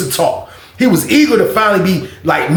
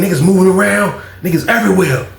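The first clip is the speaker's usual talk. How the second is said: ordinary delivery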